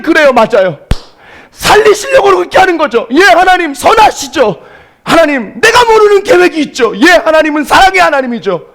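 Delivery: high, loud earnest prayer; fervent thanks. Retold or imitated. Preacher reenacting his willed prayer in the elevator, fervent and loud. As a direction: declare thanks to God with force.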